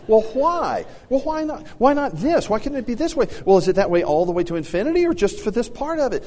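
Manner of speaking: high, eager questioning; boy quizzing mom, eager and high; curious boy. Retold or imitated. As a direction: pepper her with whys like a curious boy. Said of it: "they want to say".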